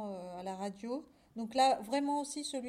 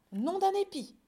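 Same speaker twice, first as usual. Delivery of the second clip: loud, high mild oath; triumphant exclamation; 9 semitones higher, 8 dB louder than usual. Acted. Farmer done building his trap, triumphant exclamation. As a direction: burst out proudly.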